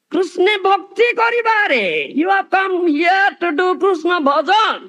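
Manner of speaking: high, fervent proclamation; proclaiming shout. Acted. Preacher proclaims why we are born, fervent shout. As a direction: call it out to the whole hall. Chant then shouted answer.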